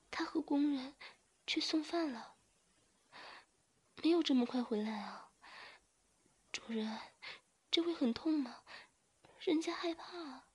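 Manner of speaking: high, frightened pleading; fearful. A narrator's reading. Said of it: "害怕地说".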